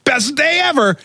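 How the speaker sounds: high, whooping mock celebration; hammed-up victory woo-hoo; mock gleeful cheer. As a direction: whoop it up like the best day ever. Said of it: "his first reaction isn't".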